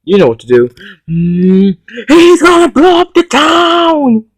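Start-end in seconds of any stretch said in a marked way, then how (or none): 2.1-4.2 alarmed shout. Pretend. Toy play, villain plot revealed.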